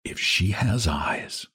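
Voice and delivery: slow, soft musing murmur; hushed, drawn-out aside; murmur to himself. A narrator's reading. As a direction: murmur it dreamily to yourself. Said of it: "Val murmured to himself".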